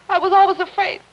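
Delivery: frightened, rising plea; fearful confession. Acted. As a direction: admit the fear as it breaks out. Wife confessing fear, voice rising.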